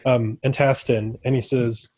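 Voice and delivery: ordinary delivery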